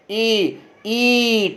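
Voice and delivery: ई pressed against the palate, held; demonstrated ee. Shown as a demonstration. Teacher demonstrating palate vowel ई to students. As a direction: push ई up toward the roof of the mouth. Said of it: "जब आप ई बोलेंगे".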